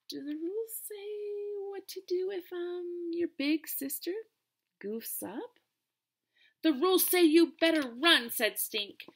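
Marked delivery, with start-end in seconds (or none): 0.1-5.6 high, timid guilty question; sheepish sister. Acted. 6.6-8.3 high and loud playful threat; mock threat. Stink threatening sister.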